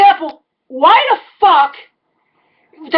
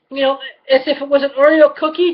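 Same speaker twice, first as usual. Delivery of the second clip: ordinary talk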